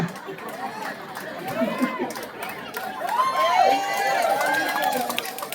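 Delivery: high laugh. Real, not acted. Laughs at own story.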